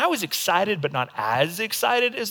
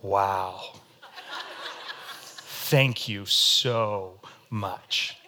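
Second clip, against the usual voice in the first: much lower, slow, deadpan thanks; flat outward thanks; 10 semitones lower, 6 dB quieter, much slower than usual. Pretend. His outward face to the gift giver, muted deadpan. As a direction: say it with no excitement at all. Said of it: "But outwardly, I'm like".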